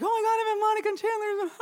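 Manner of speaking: high-pitched, giddy fangirl squeal; starstruck squeal. Retold or imitated. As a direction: squeal it like an overawed fan. Acts out starstruck arrival on set.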